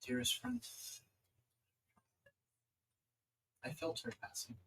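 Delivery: a gently understated goddess, with long pauses between phrases; celestial being. Acted.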